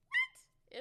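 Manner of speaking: very high, loud "What?"; incredulous squeak. Acted. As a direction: squawk it in mock disbelief. Incredulous at the name choice.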